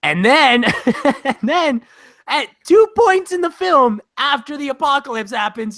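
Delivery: usual speaking voice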